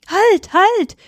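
high, loud cry of alarm; panicked shout, pitch leaping up; frightened cry. A narrator's reading. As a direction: cry out in fear to stop them. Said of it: "schrie der Bauer in Angst".

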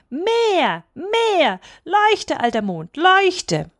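high, insistent calls, dipping midway; demanding childish cry. A narrator's reading. Boy orders old moon to shine, demanding cry. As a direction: cry each command high like a small boy. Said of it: "schrie Häwelmann".

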